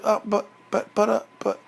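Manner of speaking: hesitant, stammering little bear; reluctant Baby Bear. Acted. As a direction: stammer timidly, afraid to speak up. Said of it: "he didn't want to speak up".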